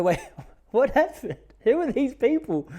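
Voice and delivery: thrilled and scandalised at once; giddy astonishment. Retold or imitated. Teen on phone hearing Andy's gossip, high and giggly. Excited reaction to Andy's news.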